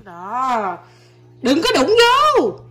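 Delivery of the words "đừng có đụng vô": drawn-out warning. Real, not acted. Warning child not to touch.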